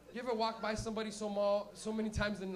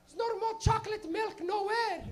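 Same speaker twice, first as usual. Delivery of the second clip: very high, slow Portuguese mom voice; mock Portuguese-accented wail; little Portuguese lady; 13 semitones higher, 5 dB louder, slower than usual. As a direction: lament it as a Portuguese mother, pitched way up. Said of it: "she's a little Portuguese lady".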